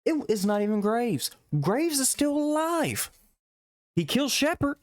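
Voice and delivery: high incredulous mock voice; mock disbelief. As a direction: squeak it out in mocking disbelief. Mocking the game's plot twist.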